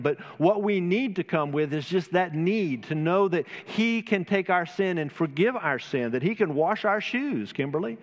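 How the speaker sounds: rising, ringing pulpit voice with lifted pitch; fervent preaching. Acted. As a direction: preach it out to the congregation, building fervour. Impassioned sermon opening.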